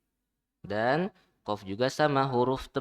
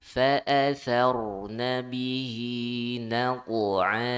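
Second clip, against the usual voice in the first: Quran recitation; 4 dB louder than usual. Shown as a demonstration. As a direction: recite it like a teacher modelling tajwid.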